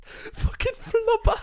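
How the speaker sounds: slow, high Geordie drawl of Flubber; Geordie accent. Retold or imitated. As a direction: stretch Fucking Flubber out in Geordie. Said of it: "Why does that sound so good in a Geordie accent".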